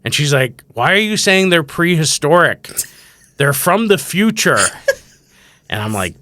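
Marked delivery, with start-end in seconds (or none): 0.7-4.6 put-on high, loud kid's correction; insistent child. Daughter correcting dad about prosthetic legs, loudly insistent. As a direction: imitate a kid emphatically setting dad straight.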